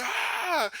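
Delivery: high comic shriek; mock scream. Imitating a wrestler meeting a female zombie, mock scream. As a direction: yelp in pretend horror.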